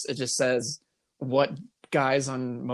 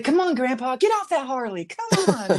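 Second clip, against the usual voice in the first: higher, coaxing voice; coaxing grandchild; 8 semitones higher than usual. Retold or imitated. Grandchild coaxes grandpa off the Harley, higher pitch. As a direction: coax grandpa like a stubborn child.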